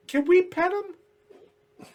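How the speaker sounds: put-on very high, slow childlike plea; little goblins pleading to pet the bunny; goblins begging. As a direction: ask to pet it like tiny eager goblins. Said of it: "they're like".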